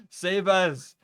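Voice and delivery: loud mock plea. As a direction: cry out for rescue, hammy.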